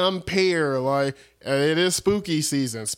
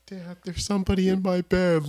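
a lightly voiced scared child, a bit higher; scared kid; 5 semitones higher than usual. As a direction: play a frightened kid calling to his dad. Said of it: "you open the closet and just".

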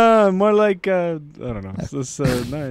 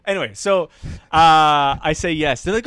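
usual speaking voice

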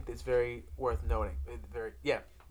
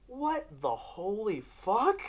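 drawn-out, higher bewildered question; baffled disbelief; 6 semitones higher, 3 dB quieter, much slower than usual. Retold or imitated. Viewer recalls reacting to three episodes, slow bafflement. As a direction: stretch each word in mock disbelief.

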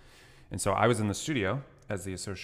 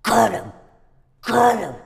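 high, loud, hissing Gollum croak; 14 semitones higher, 14 dB louder than usual. Acted. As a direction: rasp it out like a creature gloating over its prey. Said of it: "for my Gollum voice".

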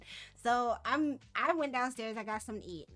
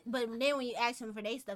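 normal talking voice

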